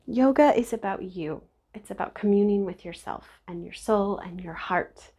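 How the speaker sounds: ordinary talk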